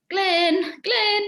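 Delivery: re-enacted call to someone out of sight. Actor calling her missing scene partner on stage, calling out.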